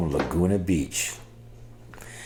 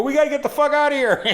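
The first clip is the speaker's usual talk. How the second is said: much higher, louder and fast laughing panic; panicked rush; 7 dB louder, faster than usual. Retold or imitated. Fleeing the cop, he yells to friends in laughing panic. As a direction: blurt it out fast, half laughing. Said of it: "I run and go".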